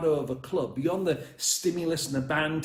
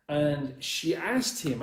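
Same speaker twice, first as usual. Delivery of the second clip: normal talking voice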